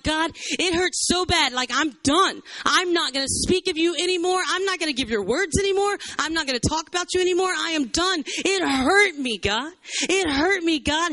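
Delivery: high, pleading lament; acted cry of someone hurt and giving up; hurt outpouring. Jeremiah telling God he is done, wounded protest. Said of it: "He's being completely real with Jesus".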